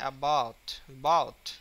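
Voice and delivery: "about" with a light "a" and a loud, heavy "bout"; teacher's demo of "about", second syllable hit hard; stress on BOUT. Shown as a demonstration. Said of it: "এ স্ট্রেসটা কম".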